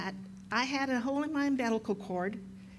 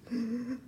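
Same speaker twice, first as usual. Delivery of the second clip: imitated gasp; 3 semitones higher than usual. Imitates son unable to breathe.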